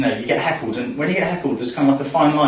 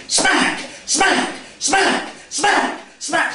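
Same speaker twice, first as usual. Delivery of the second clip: high-pitched repeated mock slap cries; smacking for effect; 12 semitones higher, much slower than usual. Mum spanking her kid, high exaggerated sound-effect cries. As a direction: punch out each word high, like a slap. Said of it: "I'm doing that for effect".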